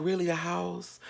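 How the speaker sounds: a high, quiet "really?"; surprised. Retold or imitated. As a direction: caught off guard by good news. Surprised at offer.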